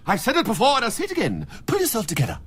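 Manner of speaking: usual speaking voice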